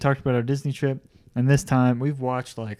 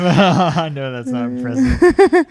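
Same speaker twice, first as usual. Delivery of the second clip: louder laughing protest; 11 semitones higher, 9 dB louder, much slower than usual. Real, not acted. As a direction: laugh through the words. Laughing defensive reply.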